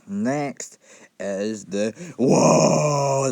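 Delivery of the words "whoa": loud, growling orc roar; orc impression. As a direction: bellow it like a brutish orc. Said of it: "that's my best orc impression".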